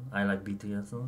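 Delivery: put-on light Korean parody accent. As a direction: say it in a mock Korean-accented English. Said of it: "Korean parody voice".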